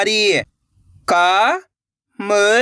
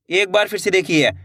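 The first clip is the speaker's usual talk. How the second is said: ordinary delivery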